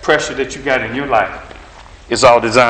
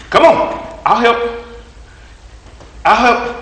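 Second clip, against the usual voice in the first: coach urging; 8 semitones higher, 4 dB louder than usual. Retold or imitated. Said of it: "he'd say".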